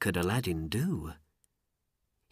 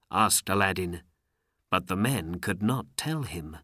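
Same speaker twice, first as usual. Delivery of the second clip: ordinary delivery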